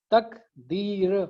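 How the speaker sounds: तकदीर slowly split into syllables; syllables spelled out. Shown as a demonstration. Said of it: "तीन syllables हैं".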